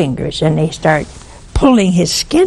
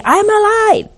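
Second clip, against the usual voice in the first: much higher, straining call; calls out to be heard by his wife; desperate cry. Retold or imitated. Ghost of the dead man cries out to his wife, much higher.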